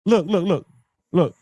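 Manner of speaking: usual speaking voice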